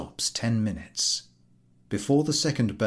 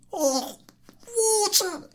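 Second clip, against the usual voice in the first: high gasping cry; gasp, clutching the heart. Acted. Invalid to the collector, gasping in alarm. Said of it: "gasps the invalid".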